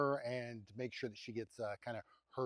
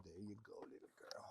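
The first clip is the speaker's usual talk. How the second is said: soft coo fading to whisper; 5 semitones lower, 18 dB quieter than usual. Real, not acted. Giving a heifer her treat, fading whisper. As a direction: ease off to nothing as she takes it.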